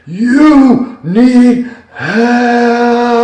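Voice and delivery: booming, very high, very slow and very loud divine call; an overwhelming command from above; thundering voice. Retold or imitated. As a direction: boom it out like a voice from heaven. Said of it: "I hear a thundering voice".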